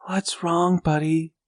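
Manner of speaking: a hint of a worried mom woken at night; mother's voice. A narrator's reading.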